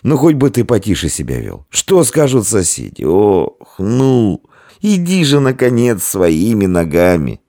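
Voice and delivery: high, strained nagging; groaning, worn-out complaint; exasperated pleading. A narrator's reading. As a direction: beg a heavy drunk to cooperate. Innkeeper begging while hauling drunk.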